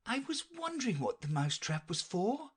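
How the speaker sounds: high-pitched, puzzled questioner; Alice. Acted. Alice points out the knight's beehive, high polite voice. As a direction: lift the voice high for a polite, observant listener.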